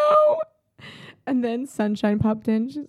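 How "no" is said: much higher, cracked crying "No."; retold sobbing no. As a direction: mimic a sobbing reply from the tent.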